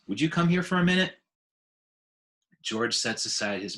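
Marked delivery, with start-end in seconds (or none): 0.1-1.2 loud, high, fast urgent call; a girl calling across to her dad, quick and loud. Acted. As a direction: hurry him over, worried. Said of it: "Julia calls".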